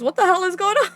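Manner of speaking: high, loud exclamation; bewildered outburst. Real, not acted. Player has so many questions about the story, bewildered outburst. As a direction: burst out in baffled disbelief. Bewildered reaction to the game's events.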